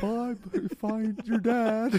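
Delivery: high, slow slack-jawed Mister Narwhal; dopey voice. Retold or imitated. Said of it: "dopey voice".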